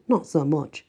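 ordinary delivery, nothing marked

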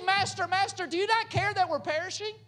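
high, frantic cry to the master; panicked plea. Acted. Frightened disciples waking Jesus in the storm, panicked plea. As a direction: beg him to wake up before you drown.

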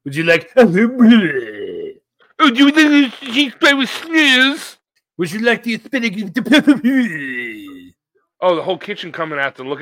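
hammed-up French waiter accent; mock French accent. Mocking a waiter offering spinach, hammed-up French accent. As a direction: put on a silly French accent.

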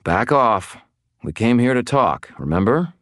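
insistent reminder; firm. A narrator's reading. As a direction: hold them back with authority. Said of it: "I said firmly".

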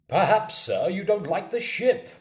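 loud, high, indignant challenge; angry squire. A narrator's reading. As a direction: throw it back angrily at the captain. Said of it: "said the squire angrily".

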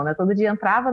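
ordinary delivery

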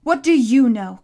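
loud, angry burst; spat out. A narrator's reading. Boy rounding on the monster, spitting it out. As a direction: spit the words out with force. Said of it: "Connor spat".

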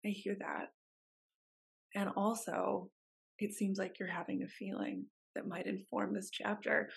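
gently validate, barely above a murmur; soft therapist voice. Retold or imitated. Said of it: "And I was like".

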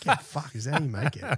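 high, laughing retold disbelief; half-laughing mock amazement. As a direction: laugh through the question in disbelief. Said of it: "I was like".

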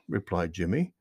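plain reading voice

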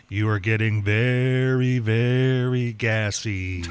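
slow, drawn-out hypnotist intoning. Retold or imitated. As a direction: lull them like a stage hypnotist. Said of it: "somebody hypnotizing somebody".

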